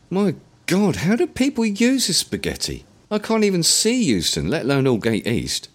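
overwhelmed by the map; panicked meltdown. A narrator's reading. William lost at the Tube map, panicked meltdown. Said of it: "had a complete meltdown".

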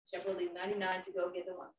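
ordinary talk

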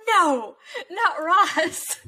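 high, slow, loud wail with a laugh; mock-heartbroken plea through laughter; dismayed protest. Co-host reliving her shock at Ross, dismayed.

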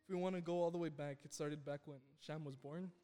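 ordinary delivery

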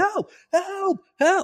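very high cries without full volume; comic imitation of panicked screams; mock screaming. Panicked cadet ignoring the injured jumper, mock screaming. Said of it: "he starts screaming".

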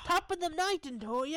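high, loud mock-Irish sign-off; hammed-up Irish lilt; playful Irish accent. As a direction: call out a jokey Irish farewell. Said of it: "that's my Irish accent".

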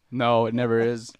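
loud, slow deadpan; flatly loud letdown; upselling cashier. Pretend. Roleplay cashier reply.

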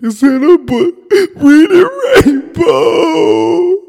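very high, slow, wailing mock sob; imitating crying. Imitating Donald Glover's character crying over Reading Rainbow, high and wailing. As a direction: cry the words out like a starstruck fan. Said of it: "was, like, crying".

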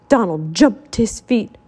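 ordinary delivery

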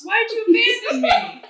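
high, laughing complaint; laughing whine. Acted. The abandoned hero whines at his friends, laughing.

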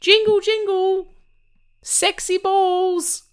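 high, slow squeaky elf voice; sing-song it like a cheeky Christmas elf. Pretend. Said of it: "I was being an elf".

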